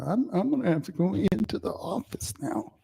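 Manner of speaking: mock a sulky office worker; whiny attitude. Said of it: "attitude of a girl I saw on Twitter".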